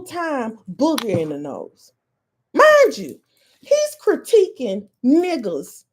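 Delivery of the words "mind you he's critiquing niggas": incredulous. Real, not acted. Mocking clip man.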